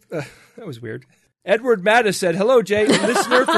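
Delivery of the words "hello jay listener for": a pushy, cocky greeting that collapses into laughter; attitude-laden listener voice. Pretend. Reading a listener email with attitude, breaks into laughter. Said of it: "I gave the listener an attitude".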